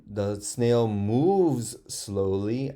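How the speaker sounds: moves said with an oo sound, slowly; corrected sentence read slowly. Shown as a demonstration.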